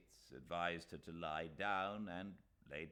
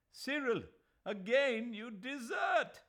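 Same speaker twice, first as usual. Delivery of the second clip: high, loud reproach; crying out; 11 dB louder than usual. A narrator's reading. Aziz crying out at Cyril for deserting, loud reproach. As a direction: cry out his hurt at the friend. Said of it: "cried Aziz".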